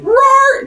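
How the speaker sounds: loud, high-pitched mock roar; imitated snake roar. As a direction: roar high, playing a monster. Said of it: "And the snake".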